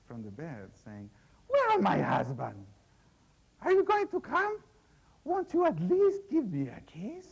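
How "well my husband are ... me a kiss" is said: high, coaxing lady calling from the bed; a bride teasing her new husband from bed, high and coaxing. Acted. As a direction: lure him over, high and inviting.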